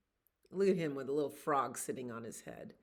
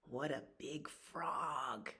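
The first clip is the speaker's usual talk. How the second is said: plain reading voice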